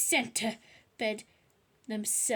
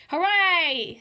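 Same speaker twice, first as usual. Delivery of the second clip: loud, higher delighted cheer; 7 semitones higher, 12 dB louder than usual. A narrator's reading. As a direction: cheer with glee. Peter cheering bedtime.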